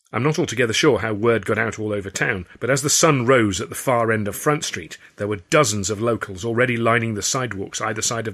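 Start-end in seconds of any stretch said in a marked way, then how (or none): none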